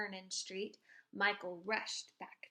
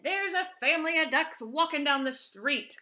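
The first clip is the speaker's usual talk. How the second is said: policeman reporting; 5 semitones higher, 10 dB louder than usual. Acted. Policeman phones headquarters.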